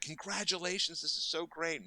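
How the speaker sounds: high-pitched delighted well-wishing; gushing congratulations. Retold or imitated. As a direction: imitate a thrilled friend congratulating. Acts out star congratulating her.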